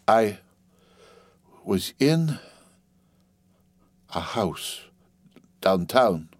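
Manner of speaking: hushed, one word at a time; long pauses between words, voice sinking; halting whisper. A narrator's reading. Wife confessing to husband, haltingly whispering. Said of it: "she began to whisper, one word at a time".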